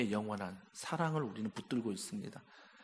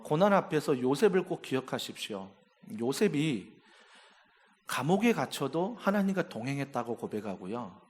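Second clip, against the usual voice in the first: normal talking voice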